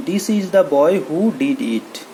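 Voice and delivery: put-on this is the boy who did it said very slowly; slow example sentence. Shown as a demonstration. Teacher showing students an example, very slowly. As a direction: dictate the example word by word. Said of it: "ᱫᱟᱹᱭᱠᱟᱹ ᱠᱟᱹᱴᱤᱡ ᱧᱮᱞ ᱛᱟᱵᱚᱱ ᱯᱮ".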